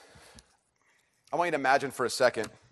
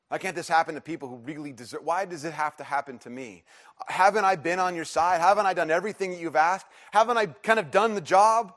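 mounting protest, higher and louder, easing at the end; indignant pleading; 5 semitones higher, 5 dB louder than usual. Acted. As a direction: plead your case to God. Acts out sufferer protesting to God.

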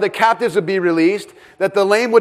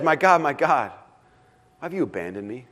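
low, fading, forsaken cry sinking much softer; anguished lament; 3 semitones lower, 5 dB quieter than usual. Retold or imitated. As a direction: let it sink into desolate quiet. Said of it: "He says".

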